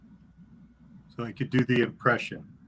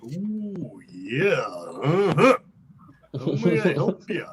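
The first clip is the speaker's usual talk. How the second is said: slow gravelly wrestler growl drawling uh-huh; Macho Man; slower than usual. Retold or imitated. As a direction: answer the phone as Macho Man, all swagger. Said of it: "the first time I ever did Macho Man".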